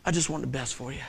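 soft, hushed, heartfelt; tender father. Retold or imitated. Dad explaining himself to his son, tender and quiet. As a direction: speak gently, with pauses. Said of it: "And I go".

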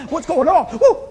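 a loud whoop of alarm at his own fright; mock panic. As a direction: act out a sudden panic with a whoop.

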